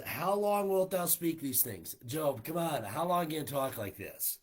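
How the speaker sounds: impatient Bildad scolding his friend; impatient rebuke. Acted. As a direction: confront him with exasperated force. Voicing Bildad rebuking Job.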